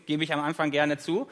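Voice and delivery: ordinary talk, nothing marked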